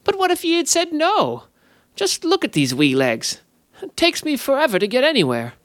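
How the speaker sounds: high, pleading little fey voice; whining sprite. A narrator's reading. The sprite pleads with Alyssa, high and whiny. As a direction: play the poor wee legs for sympathy.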